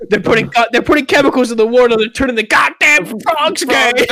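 ranting. Retold or imitated. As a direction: rant it fast and loud. Ranting conspiracy meme quote.

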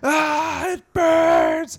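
high, loud imitated scream; mock freak-out. Retelling the scene where he freaks out, mock screaming. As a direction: act out a burning man's freak-out at full pitch. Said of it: "when he freaks out and he's like".

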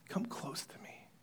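tender whispered invitation. Acted. Joseph beckoning his stunned brothers, whispering. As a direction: draw them in with a whisper.

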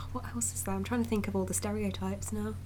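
softly whispered to herself; hushed thinking-aloud whisper; whispered aside. Real, not acted. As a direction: mutter it half to yourself while thinking.

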